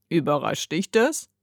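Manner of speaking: low, soft bird's question; bird character. A narrator's reading. Bird questions startled Heidi, low creature voice.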